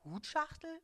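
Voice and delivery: high, disbelieving question; a husband baffled by the gift; incredulous echo. Acted.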